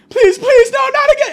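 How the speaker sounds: put-on very high shrieked plea in London accent; hammed-up London-accented screaming; panicked London accent. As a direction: beg at the top of a squeaky London voice. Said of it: "he has a London accent".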